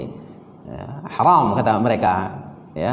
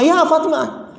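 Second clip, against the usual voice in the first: high, sharp call of the wife's name; husband calling out; slower than usual. Retold or imitated. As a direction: bark her name out bluntly. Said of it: "Istrinya bernama Fatimah".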